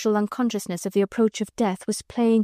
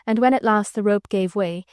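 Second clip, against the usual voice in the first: usual speaking voice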